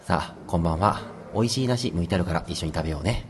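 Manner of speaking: put-on like a pretty-boy game voice actor; right in your ear. Shown as a demonstration. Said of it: "耳元で囁くシリーズ". (耳元で優しく語りかける声)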